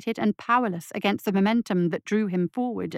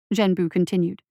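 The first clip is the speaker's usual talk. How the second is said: usual speaking voice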